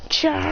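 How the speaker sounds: high, drawn-out attack yelp; battle cry. Acted. Sakura jumping in the air, battle cry.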